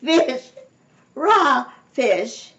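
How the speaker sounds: high, loud, outraged cries; screaming in disgust. A narrator's reading. Missus Ralph discovers raw fish, screaming. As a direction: cry out each word in horror. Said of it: "she screamed".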